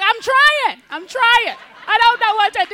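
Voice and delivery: very high, loud whiny plea; mock pleading. Daughter answering her scolding mom, high whiny plea. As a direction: whine it back at mom.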